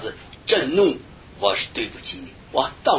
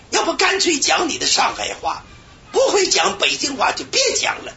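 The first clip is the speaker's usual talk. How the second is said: mock scornful critique; 14 semitones higher than usual. Retold scornful criticism of preacher.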